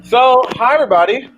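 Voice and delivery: high, loud eager greeting; real excited welcome; excited hello. Real, not acted. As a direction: throw a big happy hello to the room. Opening host greets the audience.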